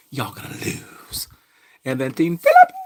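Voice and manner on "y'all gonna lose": whispered taunt. Retold or imitated. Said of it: "something like".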